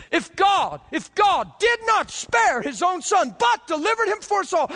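preacher shouting the promise; shouted scripture. Real, not acted. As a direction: cry the verse out.

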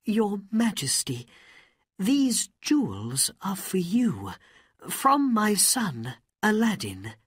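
high, earnest offering; humble mother. Acted. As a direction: present the gift with pride and humility.

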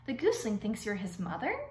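ordinary delivery

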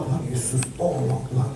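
low, soft, murmured plea; quiet prayer. Retold or imitated. A quiet plea when the sick pray. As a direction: pray it softly under the breath. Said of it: "They don't even pray".

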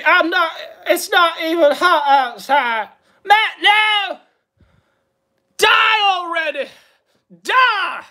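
high-pitched, strained impression voice; put-on wailing yell; Ethan Ralph. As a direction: cry it out high, hammed-up. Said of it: "His Ethan Ralph voice".

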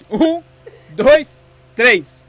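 loud, high countdown; called-out countdown. Real, not acted. Host starting the toy car race, loud countdown.

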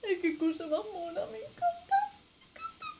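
high squealing coo over something cute; me encanta squeaked sky-high. Real, not acted. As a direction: gush like over a kitten. Cooing over cute game creature.

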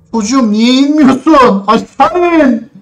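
high, loud, slow plea; exasperated begging. Real, not acted. Gamer begging his character to climb down, loud exasperated plea. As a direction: beg the character to move.

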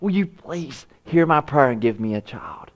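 acted plea, high then falling to breath; imitated desperate prayer, fading toward a whisper; crying out in prayer. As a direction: beg God, sinking from high to hushed. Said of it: "She is crying out to God".

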